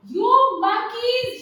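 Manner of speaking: high, loud, drawn-out scolding; scolding peddler. A narrator's reading. Peddler telling off thieving monkeys, high scolding. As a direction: call out the offenders, stretching the words.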